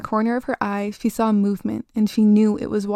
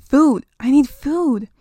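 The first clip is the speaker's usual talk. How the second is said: higher, louder eerie monster voice; hungry creature; 4 semitones higher, 6 dB louder, slower than usual. Acted. The fly creature insisting to the girl that it needs food. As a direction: growl it out, starving and otherworldly.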